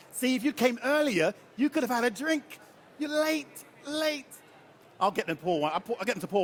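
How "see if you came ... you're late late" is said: high-pitched mock telling-off; singling out a latecomer in a high, teasing voice; teasing scolding. Real, not acted. Teases a latecomer who missed drink.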